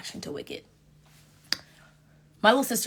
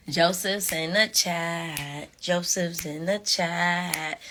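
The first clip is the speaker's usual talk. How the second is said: slow teasing announcement; much slower than usual. Real, not acted. Excited about Joseph's arrival.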